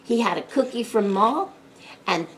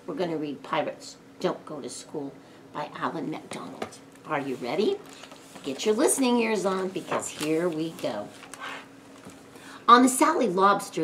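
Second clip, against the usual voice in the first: usual speaking voice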